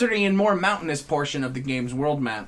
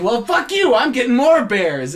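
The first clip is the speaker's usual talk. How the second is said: higher, louder comic defiance; defiant retort; 6 semitones higher, 4 dB louder than usual. Retold or imitated. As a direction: fire back, daring him. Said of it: "And I say".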